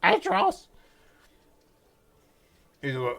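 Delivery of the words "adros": louder, very high insistent call; yelling up. Acted. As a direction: yell the name at a high pitch. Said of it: "she yells up".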